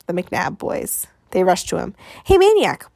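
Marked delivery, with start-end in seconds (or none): 2.2-2.9 put-on high, loud, eager kid call; excited boy bursting in; blurting. A narrator's reading. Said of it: "blurted Piper".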